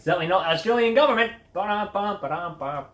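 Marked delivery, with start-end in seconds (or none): 1.5-2.8 hummed drum sting after the punchline; vocal rimshot. Acted. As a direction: sing the rimshot.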